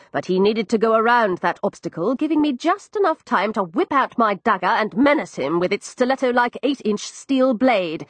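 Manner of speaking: ordinary delivery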